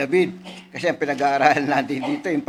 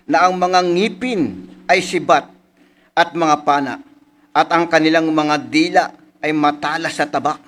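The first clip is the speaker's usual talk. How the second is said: louder, slow solemn reading; elevated preacher's reading; scripture reading; 5 dB louder than usual. Acted. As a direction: proclaim the psalm to the congregation. Reads a psalm aloud.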